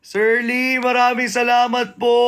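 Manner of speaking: high-pitched, grateful call; excited thanks. Real, not acted. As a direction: thank them in a high, gushing voice. Delighted thanks to a viewer.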